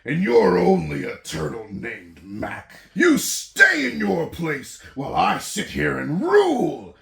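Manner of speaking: loud, high, boastful royal roar; thundering king. Acted. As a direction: boom it down from the throne. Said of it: "his royal voice thundered".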